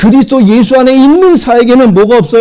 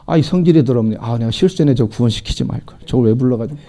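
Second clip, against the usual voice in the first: mock regretful God; 11 semitones lower, 7 dB quieter than usual. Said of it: "하나님이 하루 자매 딱 부르고 났더니".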